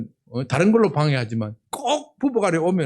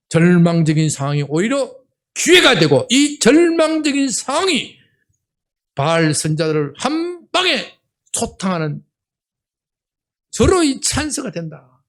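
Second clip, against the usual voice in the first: fiery preaching; 3 semitones higher, 4 dB louder than usual. Acted. Pastor preaching to his congregation at full force. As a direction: preach it with rising fervor.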